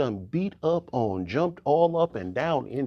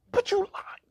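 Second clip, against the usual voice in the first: high indignant. Acted. Jacob still protesting.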